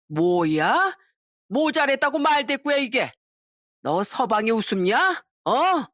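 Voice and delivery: a sudden sharp flare-up; angry drunk outburst. Acted. Drunk husband yelling at his wife, angry outburst. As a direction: bark back at the talking-back.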